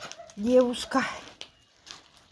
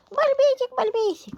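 much higher cooing nickname; pet talk; 11 semitones higher than usual. Real, not acted. Cooing a pet name to the cat in a high voice.

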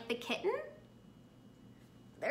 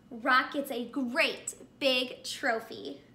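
ordinary delivery, nothing marked

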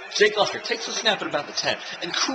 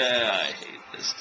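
dejected; 5 semitones lower, 4 dB quieter, much slower than usual. Real, not acted. Team losing badly.

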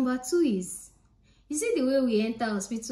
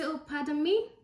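mock Canadian pardon; 6 semitones higher than usual. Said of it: "most times they say".

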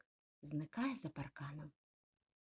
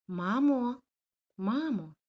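loud, high call for mum; frightened call; 6 semitones higher, 11 dB louder, slower than usual. Acted. Lost puppy calling for his mum, frightened.

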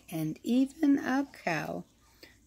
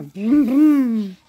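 loud, punchy boom boom; 8 dB louder than usual. A narrator's reading. Reading about the rock store, loud drum booms. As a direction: hit it like a bass drum.

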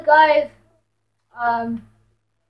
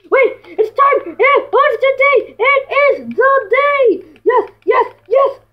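excited; 9 semitones higher than usual. Real, not acted. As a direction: rouse a sleepy friend. Waking friend excitedly.

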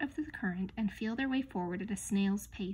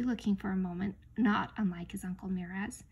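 usual speaking voice

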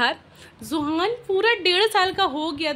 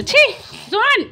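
sharp scold; 10 semitones higher, 6 dB louder than usual. Real, not acted. Mother exclaiming at baby who soaked the bed, high and loud. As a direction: bark his name in mock disgust.

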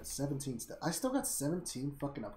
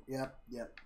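ordinary talk

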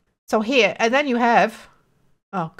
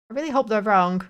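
normal talking voice

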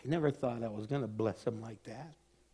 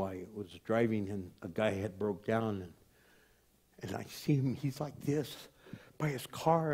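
ordinary delivery

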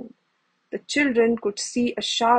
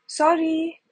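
a quick, high cry of apology; cried out; 6 semitones higher, 3 dB louder than usual. A narrator's reading. Young man bumping into Chip, cries out an apology. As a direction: blurt it out, higher, like a startled young man. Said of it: "cried the young man".

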